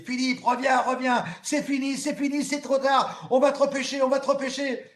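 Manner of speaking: high, loud urgent shout; coach shouting. Retold or imitated. Coach Valérie shouts from the boat, high and loud. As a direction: scream at him to come back. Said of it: "elle me crie très, très fort dessus".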